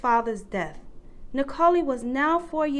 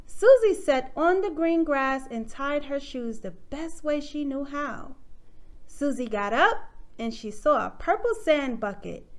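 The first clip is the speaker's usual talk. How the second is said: ordinary delivery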